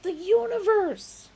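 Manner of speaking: rising excitement, drawn out; excited. A narrator's reading. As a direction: swell it grandly. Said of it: "said Lunkwell".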